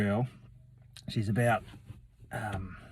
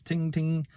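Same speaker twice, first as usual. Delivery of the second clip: high little door-bell ting; ting like a shop bell, pitched up; bright-pitched bell imitation; imitated bell noise; 7 semitones higher than usual. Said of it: "like a bell noise".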